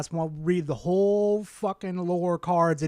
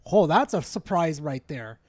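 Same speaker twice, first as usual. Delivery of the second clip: usual speaking voice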